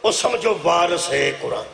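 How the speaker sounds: high, drawn-out declamation to a crowd; verse recitation. Acted. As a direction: declaim the verse slowly, then ease down. (جوشیلے انداز وچ شعر پڑھنا)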